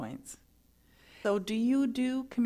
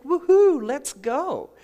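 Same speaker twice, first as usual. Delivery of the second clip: high, loud whoop of joy; excited whoop; 7 semitones higher, 11 dB louder, slower than usual. Retold or imitated. Mother off on her travels, whooping with joy. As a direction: cheer it out like a spending spree. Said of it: "it was like".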